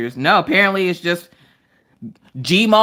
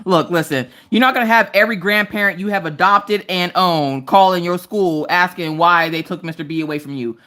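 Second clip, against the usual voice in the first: usual speaking voice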